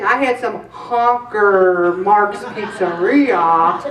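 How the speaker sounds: slow, laughing mock dieter voice; a hint of a guilty dieter confessing; mock food logger. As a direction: voice a sheepish dieter, drawn out. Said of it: "They're saying".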